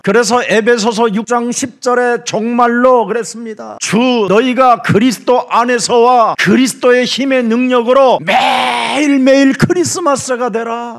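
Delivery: high, impassioned proclamation; full-force preaching. Acted. Preacher exhorting the congregation to be strong in Christ, full force. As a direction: build to a ringing shout.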